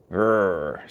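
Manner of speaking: low growled grr; low grumpy growl. A narrator's reading. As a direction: growl it in a sulk. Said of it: "said brother".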